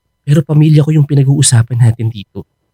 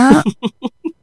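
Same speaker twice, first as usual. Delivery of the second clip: short high laugh at her doubt; amused laugh; 9 semitones higher than usual. Acted. Teasing girlfriend's doubt.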